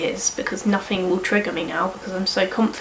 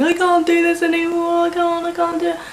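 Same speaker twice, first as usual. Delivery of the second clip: high, strained, imitated sob on the phone; retold sobbing; 9 semitones higher than usual. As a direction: replay the breakdown in a high, crying voice. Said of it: "I'm, like, sobbing down the phone".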